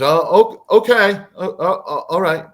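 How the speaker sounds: high, stammering compliance; flustered tall gray. Retold or imitated. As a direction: give in, tripping over the words. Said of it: "And the tall gray goes".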